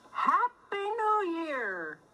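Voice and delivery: bright, drawn-out festive greeting; cheery greeting. Acted. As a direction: call it out like a New Year wish. Holiday greeting in rhyming book.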